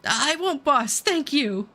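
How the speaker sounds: high, meek thanks to the boss; grateful henchman. Acted. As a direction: thank the boss like a cowed underling. Henchman grateful after being spared.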